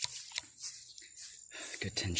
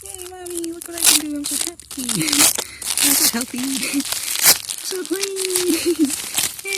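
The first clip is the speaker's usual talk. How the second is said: put-on very high, loud childlike squeal with giggles; squeaky plant voice; 9 dB louder than usual. Voicing the plant to its Mommy, high childlike squeal. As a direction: squeal like a delighted little child. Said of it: "I had to say it like that".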